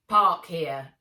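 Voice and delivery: loud, blunt command; curt order. Acted. Thin man orders Nat where to park, loud and curt. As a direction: bark the order across the yard.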